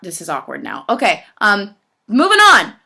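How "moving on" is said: high, loud, drawn-up change of subject; breezy loud deflection. Real, not acted. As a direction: call it out big to escape the awkwardness. Brushing off an awkward moment.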